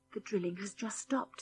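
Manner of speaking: hushed whisper, barely voiced; soft unvoiced whisper. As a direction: breathe it out so no one hears.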